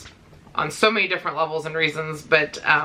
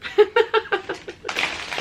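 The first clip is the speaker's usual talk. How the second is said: breathless giggle; 13 semitones higher than usual. Real, not acted. Delighted at gift.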